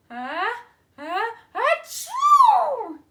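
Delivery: slow, building, loud high achoo; deer sneeze. Acted. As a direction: draw it out, then sneeze big. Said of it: "and then I sneeze".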